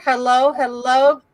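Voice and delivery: normal talking voice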